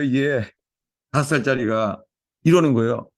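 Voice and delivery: usual speaking voice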